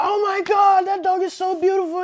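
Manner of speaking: high, loud squealing gush; gushing passersby. Retold or imitated. Trainer imitating strangers gushing over his fluffy dog, high squeal.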